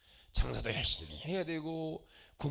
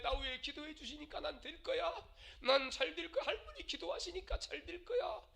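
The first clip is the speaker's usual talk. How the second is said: put-on childlike confidence; 11 semitones higher, 5 dB quieter than usual. Grandchild voiced trusting grandma.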